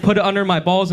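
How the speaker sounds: ordinary delivery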